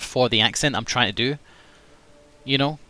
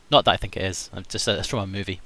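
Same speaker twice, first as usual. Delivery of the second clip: usual speaking voice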